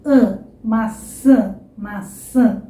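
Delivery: slow maçã with a heavy nasal ã; exaggerated nasal maçã. Shown as a demonstration. Teacher repeating maçã, nasal and slow. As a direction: stretch the a in maçã through the nose. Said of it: "eu tô exagerando aqui".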